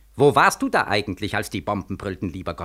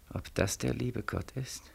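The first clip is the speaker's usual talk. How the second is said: slow and much softer; hushed wondering; 3 semitones lower, 14 dB quieter than usual. Acted. Beckmann seeing the old man, hushed and slow. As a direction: ask it almost to yourself.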